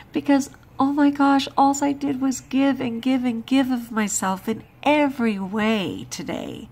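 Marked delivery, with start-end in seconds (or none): 0.1-3.2 exasperated outburst. Real, not acted. Exasperated venting.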